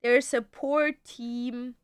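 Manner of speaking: ordinary talk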